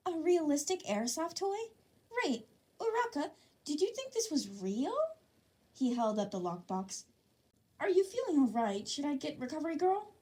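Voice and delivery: high, syrupy concerned voice; mock innocence. A narrator's reading. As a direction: pretend sweet worry to gaslight. Midoriya gaslights innocently.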